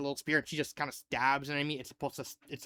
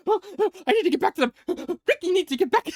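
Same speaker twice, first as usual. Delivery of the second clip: high, loud, breathless mock panic with gasps; panicked gasping; 9 dB louder, faster than usual. Ricky frantic about getting back to the others, gasping mock panic. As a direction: gasp and rush the words in a worried way.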